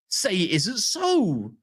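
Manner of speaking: slow, hammed-up say it isn't so; mock despair. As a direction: plead theatrically. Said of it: "mock despair".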